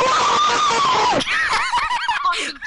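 loud, very high screaming laugh; shrieking laugh. Real, not acted. Friend laughing at the annoyed player, loud shriek.

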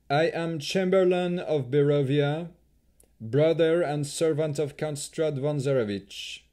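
put-on Dusk Elf accent. A narrator's reading. Chamberlain introduces himself to the party, haughty Dusk Elf accent. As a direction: proclaim his title in a Dusk Elf accent. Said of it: "in the distinctive accent of the Dusk Elves".

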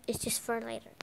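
ordinary talk

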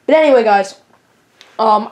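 usual speaking voice

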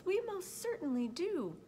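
high, swooping tiny voice; eager little speck, pitched high; piping little voice. A narrator's reading. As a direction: pipe up as a very small creature. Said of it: "piped the voice".

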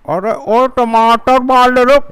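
high call across the street. Acted. Vendor calls out to crowd.

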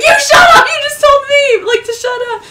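very high, loud squawked retort; acted-out angry comeback. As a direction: imitate a panicked sister firing back. Sister snaps back in retold quarrel.

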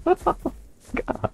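high airy laugh. Real, not acted. Laughing after bullying.

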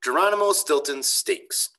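ordinary delivery, nothing marked

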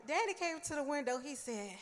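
normal talking voice

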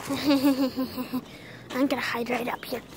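quiet hiding voice, humming softly; hushed. Real, not acted. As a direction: keep it hushed so nobody hears. Hiding quietly during hide and seek.

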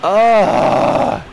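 loud, high disgusted groan then insult; real angry yell; angry outburst. Real, not acted. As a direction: let the frustration burst out. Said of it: "that really pissed me off".

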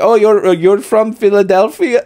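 put-on high Mexican accent drifting into Indian; hammed-up accent that comes out Indian; botched Mexican accent. Imitating the guy asking about Philadelphia, botched Mexican accent. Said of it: "I sound Indian".

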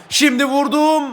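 high, loud, stretched shot call; excited shout. Real, not acted. Gamer calling his own shot on goal, excited shout. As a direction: build up and burst on the shot. Shot climax of running commentary.

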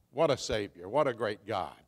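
usual speaking voice

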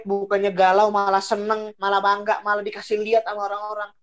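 ordinary talk, nothing marked

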